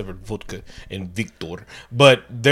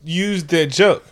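slow mock complaint; 3 semitones higher, slower than usual. Real, not acted. Mock outrage continues.

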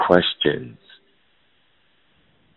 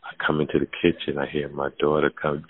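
ordinary talk, nothing marked

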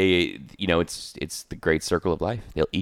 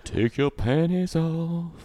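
higher, slow, hammed-up sultry line; mock seductive voice; 9 semitones higher, 3 dB quieter, much slower than usual. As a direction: draw it out like a cheesy late-night seducer. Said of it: "you just turned that sex up".

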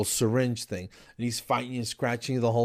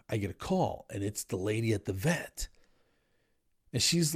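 ordinary talk, nothing marked

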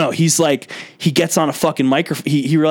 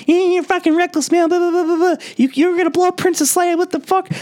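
much higher, squeaky mock scold; hammed-up high falsetto nag; mocking girly voice. Admiral scolding reckless Poe in a squeaky mock voice.

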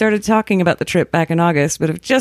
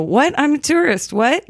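mock-confused tourist, pitched up; feigned confusion; 7 semitones higher than usual. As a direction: play the clueless tourist, voice rising. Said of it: "And just look confused".

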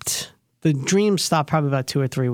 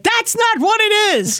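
high-pitched, drawn-out mock yell; retold yelling; 3 dB louder, slower than usual. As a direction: imitate an upset sister's protest, pitched way up and slow. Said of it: "She just started yelling at me".

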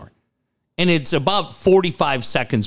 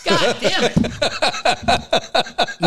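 drawn-out groan; 11 semitones higher, much slower than usual. Real, not acted. Groaning at rereading the liner.

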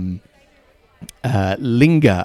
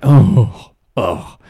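smug busy sigh; 6 dB louder than usual. Retold or imitated. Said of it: "that tone is like".

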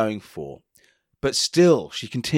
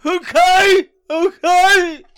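high, loud blubbing okays; mock sobbing man; 8 dB louder, much slower than usual. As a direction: whimper it like a crying man. Continues cry wanker act.

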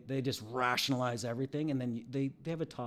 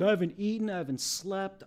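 higher, louder mock complaining; 7 semitones higher, 5 dB louder than usual. Friend griping at him at a party, higher and louder. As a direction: whine and grumble at the listener. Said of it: "started bitching at me".

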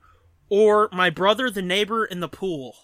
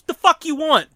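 loud, higher confrontational demand; mock angry challenge; 7 semitones higher, 7 dB louder than usual. Dad standing up to confront junker, retold angry challenge. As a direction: challenge him as a protective dad.

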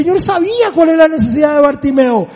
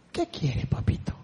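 much lower and softer, gentle; soft tender father voice; tender reply; 11 semitones lower, 16 dB quieter than usual. Retold or imitated. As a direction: answer like a patient father. God answering gently.

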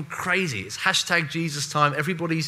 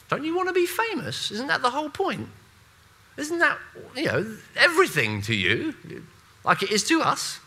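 much higher, incredulous mock questions; mock crowd voice; 11 semitones higher than usual. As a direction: put on the voice of a fame-hungry crowd. Mocking the crowd's craving for fame.

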